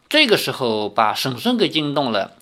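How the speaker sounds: ordinary delivery, nothing marked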